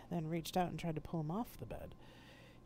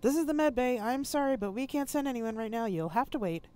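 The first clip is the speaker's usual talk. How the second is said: higher, louder voice as if through a channel; med bay staff brushing off the call over the comm; 8 semitones higher, 7 dB louder than usual. Acted.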